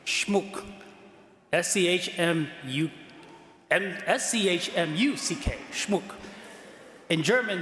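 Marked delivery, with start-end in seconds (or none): 0.1-0.6 "Schmuck" with a German oo vowel; German pronunciation. Shown as a demonstration. Priest repeats German "Schmuck" for the congregation, with its oo vowel. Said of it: "pronounce it the German way". 5.7-6.0 "Schmuck" said with a German oo vowel; German pronunciation. Shown as a demonstration. Priest rounds off spelling with German "Schmuck", oo vowel. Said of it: "pronounce it the German way".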